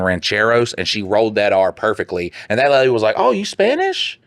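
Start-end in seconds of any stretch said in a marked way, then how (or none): 3.2-4.2 high, drawn-out retold surprise; surprised question. Lady mimicked after hearing a rolled R, surprised. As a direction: ask it slowly, way up high, mock amazed.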